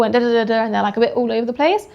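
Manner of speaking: normal talking voice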